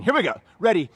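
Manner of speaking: loud drill-style cadence; shouted count. Real, not acted. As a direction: shout the numbers in steady rhythm. Trainer calls out exercise count.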